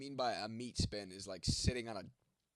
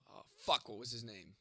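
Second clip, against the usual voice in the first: ordinary delivery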